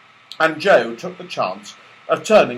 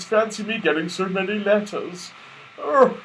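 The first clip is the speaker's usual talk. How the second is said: delighted; 9 semitones higher than usual. A narrator's reading. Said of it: "said the delighted rabbit".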